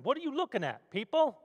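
put-on a tough Brooklyn guy snapping at the crowd in a Brooklyn accent. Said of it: "In his best Brooklyn accent".

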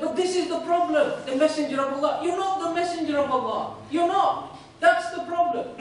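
retold angry denial, emphatic and insistent; indignant objection. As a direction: push back hotly, pitch up. Bedouin angrily rejects the Prophet's claim.

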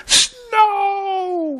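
very high, long-held mock cry; Calvin crying out. Calvin shakes his fists at heaven, long high cry. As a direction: wail it thin and high like a frustrated kid. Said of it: "and he cries".

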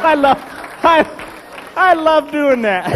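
high, loud, words through laughter; laughing. Real, not acted. Comic telling the audience his joke, laughing hard.

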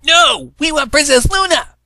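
loud, high insistent shout; crowd shout. A narrator's reading. Audience ponies shouting their demand at Trixie, loud.

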